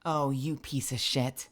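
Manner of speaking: low, soft, bitter muttering; low muttered curse. Real, not acted. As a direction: grumble it under the breath. Cursing at game quietly.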